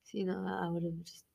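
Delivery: whispered aside; conspiratorial whisper. Real, not acted. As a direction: breathe it out as a sly whisper. Unvoiced aside.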